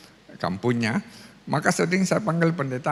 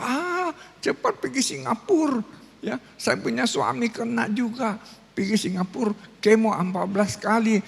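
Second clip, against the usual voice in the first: much higher, eager gossiping woman's voice; imitating a chatty woman; 6 semitones higher than usual. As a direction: play a woman pressing her advice, pitched up. Woman urges him to Singapore.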